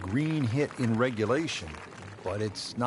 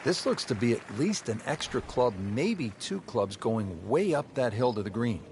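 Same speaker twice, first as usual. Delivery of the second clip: measured golf broadcast voice; golf commentator; 3 dB quieter than usual. Acted. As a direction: call the shot like TV golf. Live golf play-by-play.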